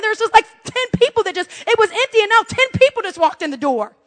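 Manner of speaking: high-pitched exasperated complaint. Retold or imitated. Waiting mom counting walk-ins, high and exasperated. Indignant thought in waiting room.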